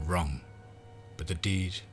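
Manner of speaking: plain reading voice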